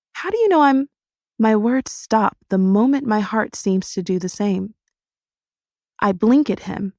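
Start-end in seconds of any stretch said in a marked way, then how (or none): 0.1-0.9 high startled question, cut off; shocked. A narrator's reading. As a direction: stop mid-word in shock.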